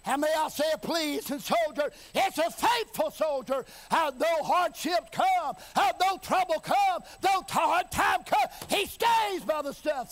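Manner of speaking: building to a high, driving preacher's cry; full-force preaching. Real, not acted. Preacher rousing the congregation to endure hardship, full-force preaching. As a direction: preach it in rising, rhythmic bursts.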